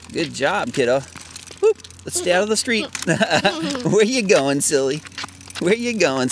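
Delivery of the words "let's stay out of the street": ordinary talk, nothing marked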